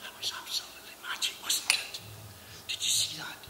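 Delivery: breathless awed whisper; hushed, amazed whisper. Real, not acted. Awed whisper after watching magic.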